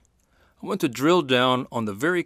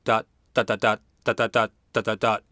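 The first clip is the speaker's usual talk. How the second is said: every "dot" equally short and detached; evenly spaced, staccato "dot, dot, dot"; even clipped syllables; faster than usual. Shown as a demonstration. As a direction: tap out each "dot" the same length. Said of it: "and not".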